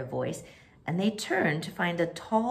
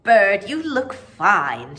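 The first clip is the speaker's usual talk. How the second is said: louder, admiring call; Mary admiring Bert's new clothes; admiring cry; 3 semitones higher, 7 dB louder, much slower than usual. Acted. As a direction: praise him with open delight. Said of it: "she cried in an admiring voice".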